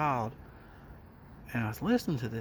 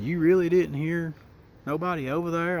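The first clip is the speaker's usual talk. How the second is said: higher and louder, pressing in disbelief; incredulous; 5 semitones higher, 5 dB louder than usual. Retold or imitated. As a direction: push him, can't believe it.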